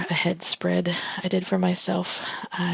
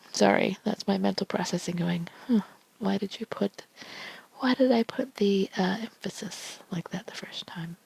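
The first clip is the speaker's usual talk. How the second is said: ASMR whisper reading tarot cards; hushed ASMR card reading; soft whisper; 4 dB quieter than usual. Real, not acted. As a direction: breathe each card's message softly. Whispered self-talk.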